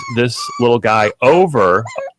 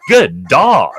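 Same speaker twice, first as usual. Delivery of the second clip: imitated gushing praise, higher pitch; mock praise; 4 semitones higher, 4 dB louder than usual. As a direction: praise the dog like a delighted owner. Imitating owners praising barking dog.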